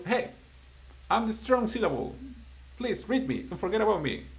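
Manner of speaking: a syllable begging to be read; talking syllable. Pretend. Teacher voices the syllable calling to the student. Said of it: "the syllable is telling you".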